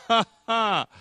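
loud, high, mocking "ha ha"; sarcastic laugh. Shown as a demonstration. Preacher demonstrating malicious humor, very sarcastic laugh. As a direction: fake a big sarcastic laugh. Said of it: "A very sarcastic".

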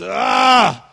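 big, high groan from the whole body; loud groan. Shown as a demonstration. Preacher demonstrating a groan for the congregation, loud full-body groan. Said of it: "when you groan, you're loud".

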